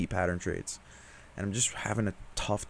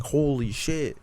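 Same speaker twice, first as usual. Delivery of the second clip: retold yell; 7 semitones higher, 4 dB louder, much slower than usual. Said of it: "I literally yelled at my setup".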